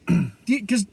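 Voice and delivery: ordinary talk, nothing marked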